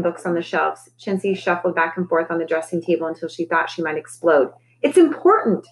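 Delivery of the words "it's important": higher, louder sudden outburst; burst out. A narrator's reading. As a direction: blurt it out, pitched up and loud. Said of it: "she burst out".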